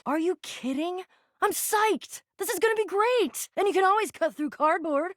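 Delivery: high-pitched, eager excitement; psyched. Acted. Game character thrilled about the coming quest, psyched.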